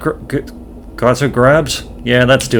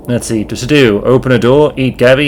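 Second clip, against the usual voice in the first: normal talking voice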